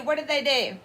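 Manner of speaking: ordinary delivery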